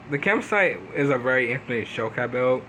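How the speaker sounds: usual speaking voice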